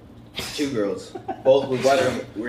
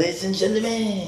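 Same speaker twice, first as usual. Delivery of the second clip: high French MC voice; showy French-accented announcer, pitched up; French-accented hosting; 6 semitones higher than usual. Shown as a demonstration. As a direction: open the show in a French accent. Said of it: "French accent on my, my hosting".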